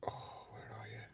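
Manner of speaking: half-whispered thinking aloud; soft, breathy wondering under the breath; whispered pondering. Real, not acted. Streamer racking brains over a viewer's whereabouts, whispered pondering.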